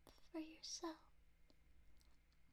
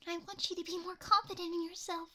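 worked up; 11 dB louder than usual. Acted. Worked up pleading.

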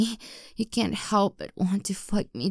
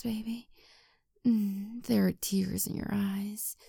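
soothing intimate murmur; 2 semitones lower, 4 dB quieter than usual. Acted. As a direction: gently lull a partner to sleep. Comforting a partner to sleep.

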